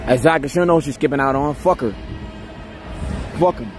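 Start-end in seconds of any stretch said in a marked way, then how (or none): none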